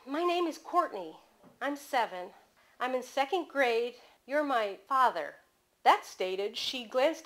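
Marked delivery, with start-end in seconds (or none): none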